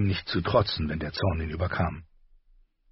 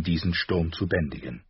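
usual speaking voice

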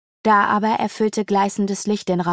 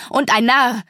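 louder, higher scornful jab; angry call; 4 semitones higher, 5 dB louder than usual. A narrator's reading. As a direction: spit out the insult. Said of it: "rief er erbost".

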